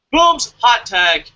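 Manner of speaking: loud, drawn-out tag-out call. Real, not acted. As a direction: call in your partner, loud and slow. Tags in co-host, calling out.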